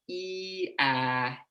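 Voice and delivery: "ee" then "ah", held vowels; drawn-out sample vowels, jaw dropping; vowel demo. Shown as a demonstration. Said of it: "go from the vowel in heat to hat".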